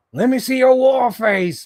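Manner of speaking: high and loud, barking order; drill sergeant demand. Retold or imitated. Ordering the figure to show its war face, loud. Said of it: "screaming face".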